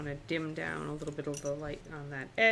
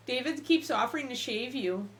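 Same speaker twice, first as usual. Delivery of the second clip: normal talking voice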